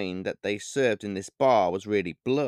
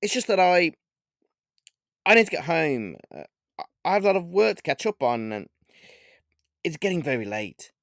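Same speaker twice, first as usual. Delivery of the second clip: nervous; 6 semitones higher than usual. Acted. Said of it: "I said nervously".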